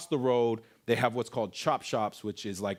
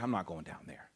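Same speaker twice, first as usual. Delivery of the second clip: muttered refusal; 6 semitones lower, 11 dB quieter than usual. Retold or imitated. Refusing the dark path.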